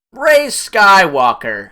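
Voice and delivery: mock-epic slow reveal; dramatic reveal. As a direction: announce the name like a climax.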